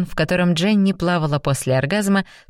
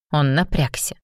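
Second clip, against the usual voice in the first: usual speaking voice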